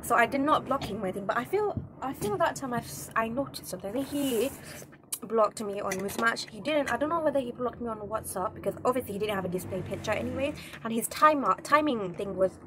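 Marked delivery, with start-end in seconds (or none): none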